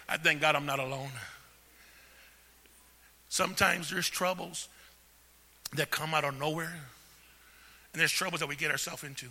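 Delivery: ordinary delivery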